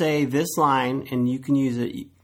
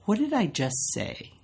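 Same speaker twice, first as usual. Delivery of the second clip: pointed question; 6 semitones higher, 5 dB quieter, slower than usual. Shown as a demonstration. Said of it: "you stop and you say".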